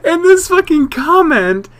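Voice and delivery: high-pitched, laughing through the words; helpless laughing. Real, not acted. Streamer reads out comment about their voice acting, laughing. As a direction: barely get it out for laughing. Cracking up over a viewer comment.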